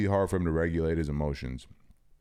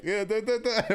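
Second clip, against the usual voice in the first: dry friend. Retold or imitated. Host imitating a friend's dry reply, exaggerated and high. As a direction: toss it off like you couldn't care less. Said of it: "he dry ass was like".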